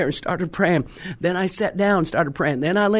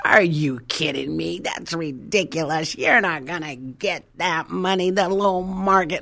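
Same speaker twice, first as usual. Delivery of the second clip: retold incredulity. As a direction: act out a scoffing agent. Retold agent scoffing at her price.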